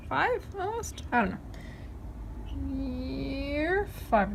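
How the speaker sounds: teasing singsong pet voice; talking to a pet. Real, not acted. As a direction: chide the cat playfully. Teasing the cat.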